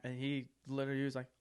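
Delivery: ordinary talk, nothing marked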